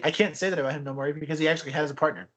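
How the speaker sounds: normal talking voice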